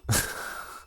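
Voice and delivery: short breathy laugh. Real, not acted. Player amused by own accent attempt, short laugh. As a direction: laugh at yourself briefly.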